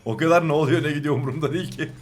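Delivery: words tipping over into laughter; real amused laugh at the end; laughing indifference. Real, not acted. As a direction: shrug it off, then crack up. Laughs at not caring in game.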